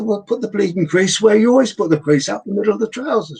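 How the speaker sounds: playing the exasperated partner; mock exasperation. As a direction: snap it like a fed-up spouse. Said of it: "I said".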